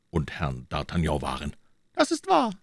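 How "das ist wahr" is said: very high-pitched king's voice; conceding king. Acted. King conceding Treville's point, high royal voice. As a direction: agree in a high head voice.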